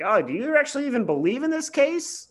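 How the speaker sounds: high mocking voice; lightly voiced scornful judge. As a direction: sneer it in a high put-on tone. Quoting the judge's jab.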